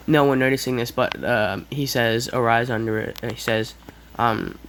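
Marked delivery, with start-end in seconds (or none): none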